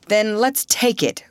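a touch of eagerness; eager cry. A narrator's reading. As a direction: call it out lightly, pitch lifted. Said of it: "cried Russ".

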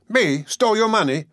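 high-pitched indignant retort; angry. A narrator's reading. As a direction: throw the accusation back, affronted. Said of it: "said Jem angrily".

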